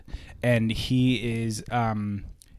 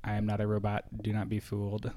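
put-on low, quiet kid robot voice; flat little robot talk; 3 semitones lower, 7 dB quieter than usual. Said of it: "doing his robot voice".

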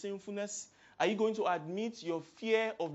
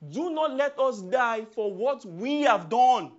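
crying out; 3 semitones higher, 7 dB louder, slower than usual. Retold or imitated. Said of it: "They cried".

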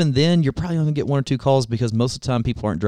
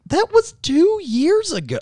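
squeaky high, slow mock reply. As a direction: pitch it way up and drag it.

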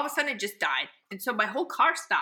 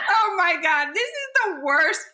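louder, higher hammed-up lament; dramatic dismay; 7 semitones higher, 5 dB louder, slower than usual. Stranded woman in the tow truck, dramatic dismay. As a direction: wail it out, slowing for emphasis. Acts out her distress to driver.

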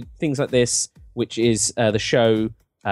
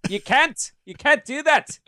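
put-on firm, much higher South African accent; 11 semitones higher, slower than usual. Telling people off, bossy South African accent. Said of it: "with a heavy South African accent".

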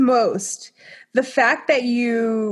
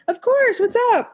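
high eager reply; 12 semitones higher than usual. Retold or imitated. Her own eager reply, acted.

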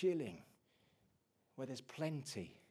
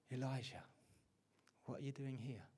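hushed, much softer voice of God; 8 dB quieter than usual. Retold or imitated. God to Elijah hiding in the cave, much softer. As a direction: drop to a gentle hush as God speaks. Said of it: "And the whisper of God came".

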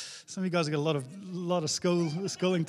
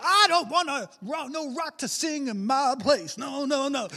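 imitating a worship leader; 7 semitones higher, 4 dB louder than usual. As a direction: preach it high and stretched out. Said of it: "He's like".

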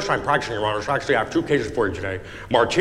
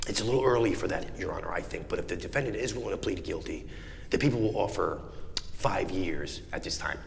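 hushed whisper; 7 semitones lower, 11 dB quieter than usual. Acted. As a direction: whisper the offer under your breath. Prosecutor whispers plea offer.

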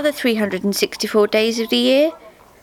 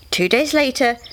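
usual speaking voice

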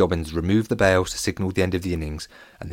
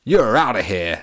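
put-on American accent; 5 dB louder than usual. A narrator's reading. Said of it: "in his best American accent".

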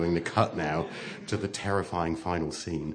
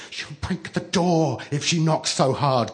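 put-on high, louder childish alarm; alarmed cry; 8 semitones higher, 3 dB louder than usual. A narrator's reading. Blue Eyes fearing the door breaks, crying out. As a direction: cry out in fright. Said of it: "cried Blue Eyes".

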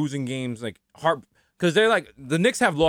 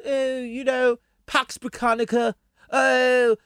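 high, hesitant mock-British royal; pitched-up caricature of innocent aristocrats; 7 semitones higher, slower than usual. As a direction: hem and haw as a doddering royal. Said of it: "got the nerve to be like".

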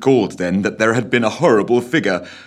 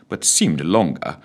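ordinary delivery, nothing marked

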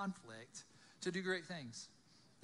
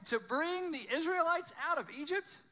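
loud, high question climbing at the end; incredulous questioning; 11 semitones higher, 7 dB louder than usual. Acted. Moses questioning God about the rescue, loud and incredulous. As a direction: throw the order back in disbelief.

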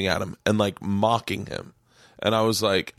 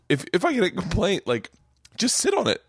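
mock indignant; 9 semitones higher than usual. Retold scolding of manager.

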